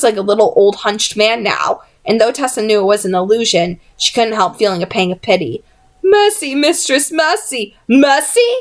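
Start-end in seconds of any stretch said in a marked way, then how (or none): 6.0-8.5 high, pleading cries; begging for mercy. A narrator's reading. Wounded goblin begs Jessamine, high and pleading. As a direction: beg desperately for your life.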